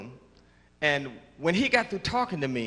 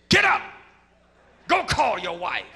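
commanding call; 4 dB louder than usual. Retold or imitated. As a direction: order him up at once. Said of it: "he says".

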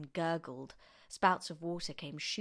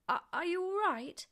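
high, hesitant polite question; 13 semitones higher, 3 dB louder than usual. A narrator's reading. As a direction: ask politely, stumbling on the start. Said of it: "George asked her politely".

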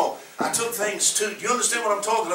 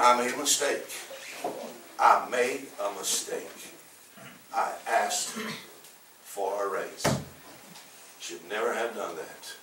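much softer, lower, ashamed admission; quiet confession; 8 semitones lower, 9 dB quieter than usual. Retold or imitated. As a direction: drop the voice low like owning a mistake. Confessing to the church men.

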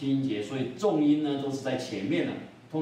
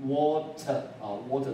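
British "water" with a strong, clear T; British-leaning model of "water" for learners; British accent. Shown as a demonstration. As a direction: say "water" British-style, T fully sounded. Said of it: "这个t呢是英国腔比较重".

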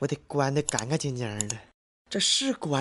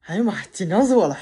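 fast, high, loud exclaim; loud relieved outburst; 6 semitones higher, 9 dB louder, faster than usual. Acted. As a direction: blurt out the relief. Relief after shutting computer.

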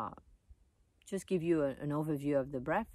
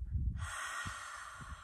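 long, soft, voiceless sigh out through the mouth; demonstrated cleansing mouth exhale; exhale sound; 7 dB quieter than usual. Shown as a demonstration. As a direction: breathe out a long airy "haaa". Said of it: "making the sound".

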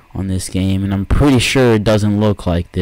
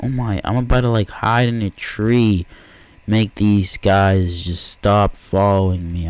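normal talking voice

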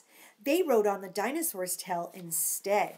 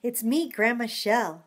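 calling out; 8 dB louder, slower than usual. Real, not acted. Grandma calling out to the girls to come listen.

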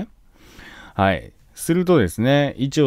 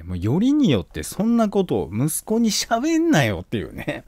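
ordinary talk, nothing marked

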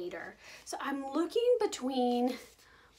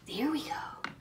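whispering; 8 dB quieter than usual. Real, not acted. Setting food down quietly.

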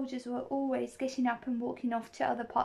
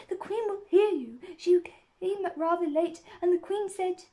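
anxious, higher, urgent warning; frightened; 7 semitones higher, 4 dB louder than usual. A narrator's reading. Rabbit warns Alice of the Queen, frightened. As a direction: warn them in a fright. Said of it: "whispered in a frightened tone".

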